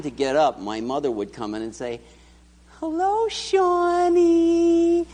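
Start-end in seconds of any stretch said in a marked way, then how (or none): 2.8-5.1 high, slow, sugary "Hello, Shawnee"; sweet mother's wake-up voice. Retold or imitated. Mother waking her sleeping son, sugary high coo. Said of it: "she was so sweet and saccharine".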